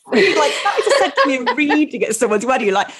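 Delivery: ordinary talk, nothing marked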